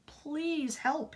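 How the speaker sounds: ordinary delivery, nothing marked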